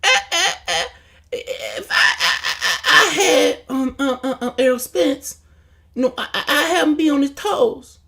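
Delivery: high, halting mock interview voice; mock stammering interview. Mocking the fighter fumbling an interview, stammering. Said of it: "with Floyd saying".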